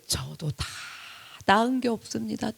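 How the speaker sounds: soft, humbled admission; humble confession. Acted. Preacher voicing a sinner's answer to God, humble confession. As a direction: confess it softly, with a pause midway.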